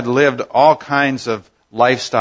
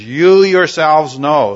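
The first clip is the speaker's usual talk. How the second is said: "You yourselves" stressed slowly, higher and louder; emphatic you yourselves; 5 semitones higher, 6 dB louder, slower than usual. Shown as a demonstration. Preacher stressing Paul's emphatic pronoun to the elders, slow and loud. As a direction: lean hard on "You yourselves", drawn out. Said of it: "Paul makes it very emphatic".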